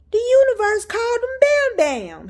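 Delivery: high, loud burst of amazement; amazed exclamation. Real, not acted. Mom astonished the universe knows her son's nickname, amazed.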